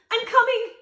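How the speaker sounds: high, loud shout of reassurance; calling out. A narrator's reading. Princess calling to the drowning boy. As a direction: call across the water. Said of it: "the princess promised".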